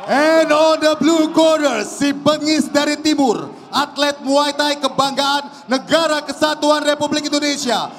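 booming, drawn-out fighter introduction; ring announcer. Acted. (pembawa acara ring yang menggelegar)

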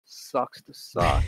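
low, very slow, deadpan sneer; dismissive sneer. Retold or imitated. Mimicking the student who kicked the tea, low slow smug sneer. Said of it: "I was like".

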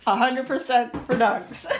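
loud laughing teasing. Real, not acted.

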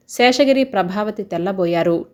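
ordinary delivery

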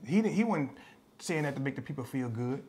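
ordinary talk

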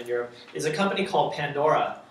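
ordinary talk, nothing marked

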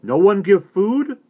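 slow, high and loud surprised question; taken aback. A narrator's reading. Little Bear surprised the boy went unfed, slow loud question. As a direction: ask it slowly, caught off guard. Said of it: "Little Bear looked taken aback".

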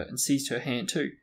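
plain reading voice

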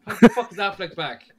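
high, loud disbelief; exasperated. Real, not acted. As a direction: throw up your hands in disbelief. Exasperated rant about Affleck.

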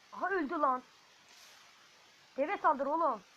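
real frustration; furious yell. Real, not acted. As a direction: yell at the camel to attack. Giant dies, urging camel on.

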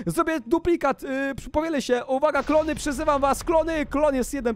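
high, excited power announcement; summoning call. Pretend. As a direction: call up an army in play. Summoning clones in the game.

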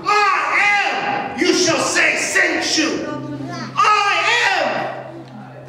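loud, high, ringing sermon voice; preaching at full force. Acted. Preacher lifting his voice to the church, loud and high.

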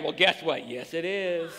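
ordinary delivery

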